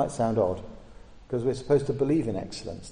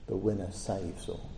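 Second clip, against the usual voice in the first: softer and lower, almost hushed; soft gospel motto; 4 semitones lower, 7 dB quieter than usual. Shown as a demonstration. Preacher contrasting the gospel with the world, softly. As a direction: say it gently, like good news. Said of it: "In the gospel, you hear".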